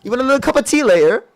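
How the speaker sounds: high, loud, badly faked British accent; overdone tea-time British accent. Shown as a demonstration. Debater offering opponent tea, terrible British accent. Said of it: "That was horrible".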